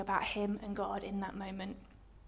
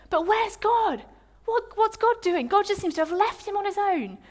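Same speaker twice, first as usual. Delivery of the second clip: anguished questioning; 8 semitones higher than usual. Acted. Voicing Jacob's despair.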